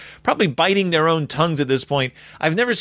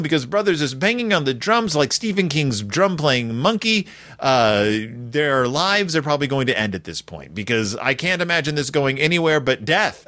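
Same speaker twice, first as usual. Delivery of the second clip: normal talking voice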